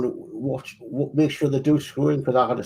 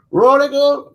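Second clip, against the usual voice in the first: high, loud newspaper seller's cry; street vendor's shout; 13 semitones higher, 9 dB louder, slower than usual. Retold or imitated. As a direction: call it out across the street like a paperboy. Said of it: "they would shout".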